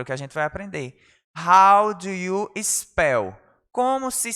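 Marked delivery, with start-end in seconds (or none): 1.4-3.4 very slow, drawn-out model sentence; slow model phrase. Shown as a demonstration. Teacher modelling for students, very slow. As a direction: spell it out slowly for the class. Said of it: "teacher models phrase slowly".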